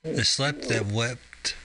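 drawn-out Seuss-style title; slow storybook reading. Pretend. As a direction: read it slowly like a picture book.

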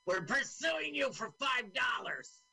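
playing the cop with a shout; mock cop voice. Voicing the cop on screen.

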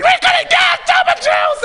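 very high shouted cheer; screeching cheer. Retold or imitated. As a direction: shriek it like the section captain. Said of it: "Darcy's like".

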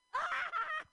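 a loud, very high laugh; shrieking laugh. Real, not acted. After ranting at the crowd, loud shrieking laugh. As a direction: burst out laughing at yourself.

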